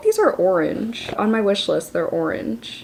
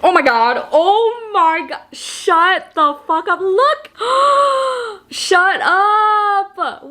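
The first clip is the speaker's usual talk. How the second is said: squealing excitement; 10 semitones higher, 3 dB louder, much slower than usual. Real, not acted. Squealing over gift.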